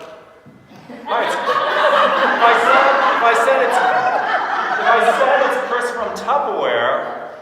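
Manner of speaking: loud, high, drawn-out announcement; exaggerated loud delivery. Shown as a demonstration. Coach mocks an over-the-top call opener, exaggerated and loud. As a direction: overblow it with stretched, booming words.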